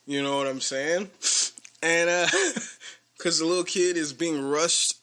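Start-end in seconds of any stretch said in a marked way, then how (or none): 2.3-2.9 laugh. Real, not acted. Laughing retelling story.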